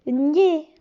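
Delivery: gné said loudly as ny plus a closed é; syllable demo. Shown as a demonstration. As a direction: blend gné clearly. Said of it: "gn et é se prononce".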